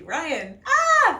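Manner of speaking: loud call then a yelp. Real, not acted. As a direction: shout his name for help. Calling for help, laughing.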